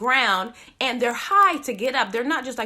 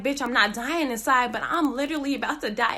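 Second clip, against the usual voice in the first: ordinary talk, nothing marked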